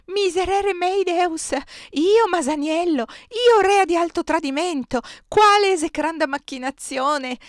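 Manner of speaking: high, loud wail of horrified disbelief; aghast cry. A narrator's reading. Superiora hearing she is accused of treason, aghast cry. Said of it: "gridò esterrefatta".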